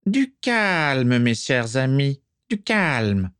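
high, slow soothing command; calming dark lord. Acted. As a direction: settle the room smoothly. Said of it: "dit Voldemort en élevant la main".

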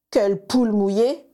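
put-on a child jeering at his little cousin; mocking taunt. A narrator's reading. Sirius jeers at his scared cousin, mocking. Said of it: "se moqua Sirius".

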